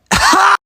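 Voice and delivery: very high, sudden loud shriek; loud outburst. Acted. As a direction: let out a neighbor-waking screech. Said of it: "tried to wake the neighbors up".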